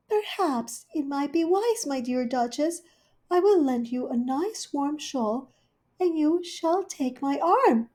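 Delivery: high, gentle fussing cat; Rivy the cat, high and considerate; kindly hostess cat. Acted. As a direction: offer it kindly. Rivy offers shawl.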